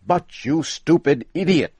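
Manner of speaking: high, loud furious shout; angry shout. Acted. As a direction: yell the insult at him. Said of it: "shouted Mister Morehouse".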